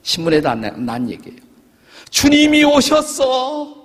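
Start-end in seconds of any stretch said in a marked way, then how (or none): 2.1-3.8 high, slow, loud cry of 주님이. Retold or imitated. Retelling the dying writer's last cry, loud and high. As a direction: call out the name with a rising exclamation. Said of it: "이렇게 소리쳤다고 그래요".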